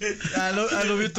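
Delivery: laughing broken English; spanglish. Retold or imitated. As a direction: mimic the balloon's English. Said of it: "decía frases en inglés".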